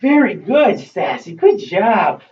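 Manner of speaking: high, loud praise for the dog; excited praise. Real, not acted. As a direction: reward the dog with big happy praise. Praising dog after it comes.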